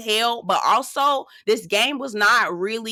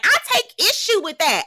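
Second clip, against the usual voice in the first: higher-pitched imitation of an indignant protest; mock loud objection; 10 semitones higher, 3 dB louder than usual. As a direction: imitate her objecting too loudly, pitched up. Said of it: "Ooh, girl, lower your voice".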